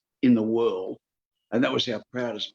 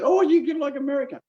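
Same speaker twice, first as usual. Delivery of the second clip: high, quick mock alarm; hammed-up high-pitched quote; mocking imitation; 13 semitones higher, 4 dB louder, faster than usual. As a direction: parrot the opponents in a raised pitch. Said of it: "they said".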